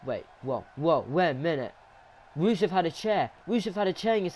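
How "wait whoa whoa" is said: ordinary delivery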